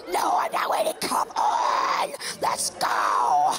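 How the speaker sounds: hoarse growled shout; hammed-up guttural urging; frantic refusal. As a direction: roar it in a low demonic rasp. Refusing to wait, racing on.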